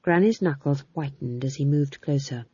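usual speaking voice

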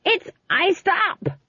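very high, drawn-out scream; stretched, high-pitched cry of alarm. A narrator's reading. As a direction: scream it slowly over a great din. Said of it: "screamed Granny".

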